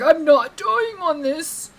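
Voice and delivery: high, loud and slow, stretching every word; retold defiant cry. Streamer retelling her fight, defiant high slow cry. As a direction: belt it out like refusing to lose. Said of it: "I was like".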